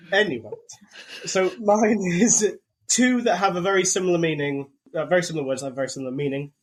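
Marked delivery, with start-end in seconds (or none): none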